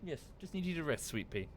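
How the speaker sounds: normal talking voice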